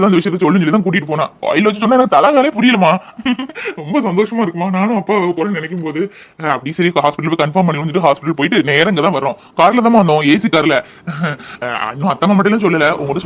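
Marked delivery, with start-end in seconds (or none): none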